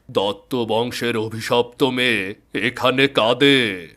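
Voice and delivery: plain reading voice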